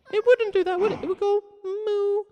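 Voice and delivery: tiny cow squeak. Shown as a demonstration. Teacher voicing a cat-sized cow for the class in a squeak.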